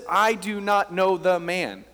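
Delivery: slow, emphatic denial; slow, heavy quoted denial; vehement denial. Retold or imitated. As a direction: deny it forcefully, drawing out each word. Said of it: "he's cursing".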